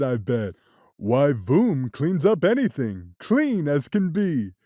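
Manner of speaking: the cat. Acted. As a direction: tease in the cat's low tone. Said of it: "said the cat".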